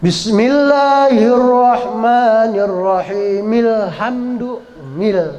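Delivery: drawn-out chanted recitation; slow recitation. Shown as a demonstration. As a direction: chant each syllable slowly. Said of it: "kalau kita mau baca wasol".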